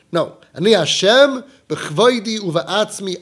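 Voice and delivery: normal talking voice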